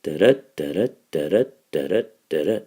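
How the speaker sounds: "Da-da" five times, each second "da" stressed; iambic rhythm. Shown as a demonstration. Teacher demonstrating iambic pentameter, chanted da-DUM rhythm on "Da-da". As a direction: beat out "Da-da, da-da" as even unstressed-stressed pairs with small gaps. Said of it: "Iambic pentameter kinda sounds like this".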